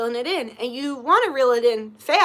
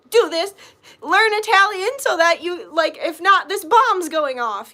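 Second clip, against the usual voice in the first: higher and louder, a hammed-up drill sergeant pushing; mock urgent demand; 6 semitones higher, 6 dB louder than usual. Mocking the inner pressure to learn Italian, hammed-up urgent command. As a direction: bark it like a fake ultimatum with a bomb ticking.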